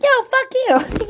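high, slow mock-angry retort; hammed-up aggressive comeback; aggressive husband. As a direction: play a husband lashing back, drawn out. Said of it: "your husband's too aggressive".

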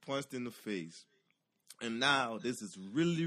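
normal talking voice

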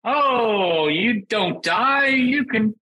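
high, slow, sing-song mockery of a happy game; mock the sugary Disney tone, drawn out; mocking cheery tone. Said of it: "It's very happy".

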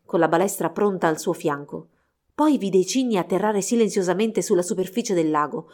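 ordinary delivery, nothing marked

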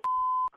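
high squeal of a laugh; burst of laughter. Real, not acted. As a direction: laugh in shock at being fooled. Laughing after prank reveal.

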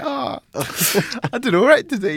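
high pleased mock voice with a laugh; smug delight. Imitating his own flattered reaction at a club, high and smug. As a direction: act chuffed at the attention. Self-satisfied retold reaction.

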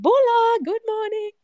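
mock Fijian hotel greeting, high and loud; imitating Fijian receptionist. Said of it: "this beautiful Fijian woman just said".

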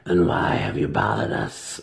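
edged demand. A narrator's reading. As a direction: demand with a sharp edge. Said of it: "an unmistakable edge in her voice".

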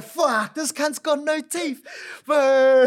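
high, loud, stunned outburst; shocked. Retold or imitated. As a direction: blurt it out in loud disbelief. Shocked at toothless opponent.

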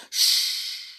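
sharp unvoiced shush; whispered shush. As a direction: hush them urgently. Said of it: "the porter whispered".

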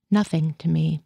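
ordinary delivery, nothing marked

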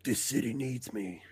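slow, low hero growl; Batman. Acted. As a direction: drop low and take it slowly. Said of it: "Batman. I just need to think of the right quote".

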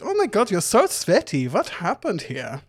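high, fussing flamboyant voice; Eriken fussing. Acted. Eriken fussing over sweaty Titus in a high coo.

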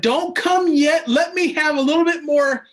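high, slow whiny plea; squeaky begging voice; mock pleading. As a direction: beg God like a greedy child.

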